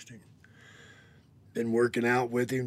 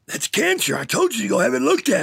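much higher and louder, a sharp alarmed doctor; retold doctor's outburst; 12 semitones higher, 14 dB louder than usual. The doctor telling him it is cancer, loud and alarmed. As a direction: blurt it like a shocked doctor. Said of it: "he said".

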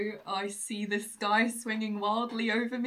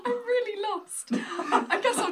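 laughing; 12 semitones higher, 5 dB louder than usual. Real, not acted. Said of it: "I'm confused".

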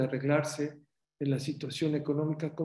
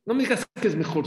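fast, higher and louder protest; heated objection; 7 semitones higher, 6 dB louder, faster than usual. Retold or imitated. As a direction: push back at the teacher. Quoting the interrupter's objection. (un alumno protesta rápido y fuerte)

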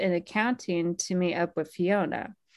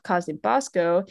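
normal talking voice

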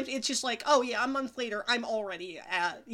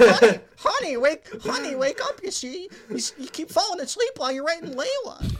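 mock wife voice; 5 semitones higher, 6 dB louder than usual.

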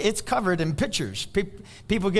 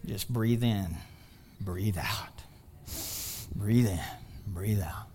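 low, soft breathing coach; calming; 11 semitones lower, 8 dB quieter, slower than usual. Pretend. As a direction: talk them down slowly, hushed and low, with pauses. Calming congregation after provocative claim.

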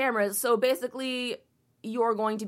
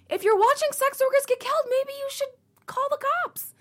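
exasperated outburst; 4 dB louder than usual. Real, not acted. Ranting at the news article about the neighbors, exasperated high outburst. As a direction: pitch shoots way up, louder, incredulous.

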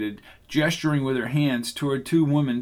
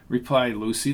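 plain reading voice

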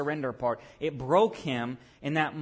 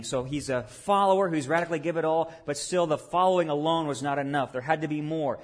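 normal talking voice

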